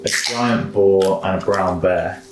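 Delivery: ordinary talk, nothing marked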